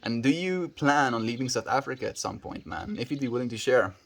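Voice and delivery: ordinary delivery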